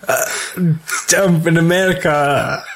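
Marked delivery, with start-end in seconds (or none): 1.2-2.6 put-on American accent. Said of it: "Do you wanna know what they sound like".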